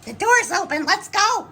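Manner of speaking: eager dogs spotting an opening. Pretend. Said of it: "It's like".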